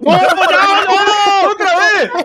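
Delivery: drawn-out high panicked cry; screaming while falling. Real, not acted. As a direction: wail it as you slide down. Slips and falls again.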